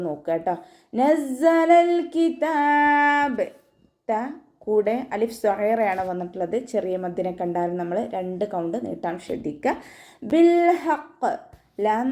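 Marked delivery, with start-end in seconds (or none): 1.0-3.5 slow, high, melodic tajweed chant; Quran recitation. Shown as a demonstration. Teacher chants the verse for students, slow and melodic. 10.3-11.3 slow, high, melodic tajweed chant; Quran recitation. Shown as a demonstration. Teacher chants the verse for students, slow and melodic.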